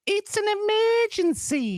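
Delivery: very high, stretched Aussie cry; Australian accent. Acted. Host showing a go-to line, high Australian accent. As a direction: wail it slowly in mock Australian. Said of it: "I always go with".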